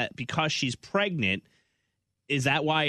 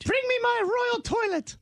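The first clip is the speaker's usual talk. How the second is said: very high shouted royal demand; mock royal. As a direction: bellow for the toilet like a pompous queen.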